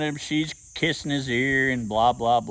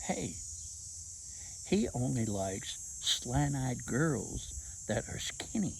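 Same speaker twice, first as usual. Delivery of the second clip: soft, hushed words in her ear; low conspiratorial murmur; whispered; 3 semitones lower, 11 dB quieter, slower than usual. Retold or imitated. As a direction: lean in and whisper the secret. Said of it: "So I whispered in her ear".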